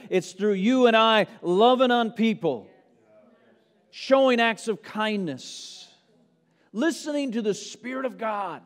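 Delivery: loud, driving sermon delivery; full-force pulpit preaching. Acted. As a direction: preach it out to the whole room. Preaching to congregation.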